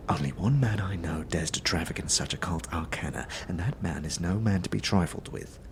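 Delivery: soft, hushed warning; conspiratorial whisper. Acted. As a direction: lean in and whisper the dread name.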